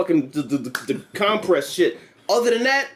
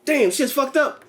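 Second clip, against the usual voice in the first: put-on higher, breathless kid telling the news; mock kid on the news; 5 semitones higher than usual. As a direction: play a kid hyped from escaping.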